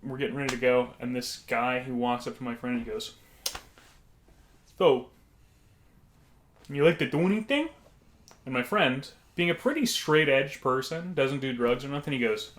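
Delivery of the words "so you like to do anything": lightly put-on voice, lifting into the question; odd customer impression. Odd stranger approaching the friend, lightly imitated question. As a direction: imitate the man sounding out the friend. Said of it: "It's how he sounded".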